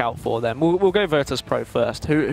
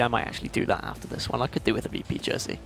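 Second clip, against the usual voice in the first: softly whispered to himself; whispered aside; 7 dB quieter than usual. Real, not acted. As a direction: whisper it like a secret. Aside to cohost.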